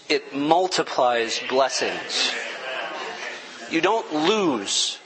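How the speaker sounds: slow, choked-up, with a tearful laugh; emotional. Real, not acted. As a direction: push the words out through tears. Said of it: "so I'm emotional".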